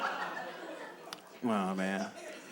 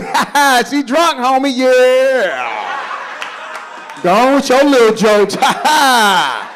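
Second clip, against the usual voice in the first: louder, jokey laughing voice; laughing friend; 5 semitones higher, 5 dB louder, slower than usual. Retold or imitated. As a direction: ham up a happy homie. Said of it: "He's like this".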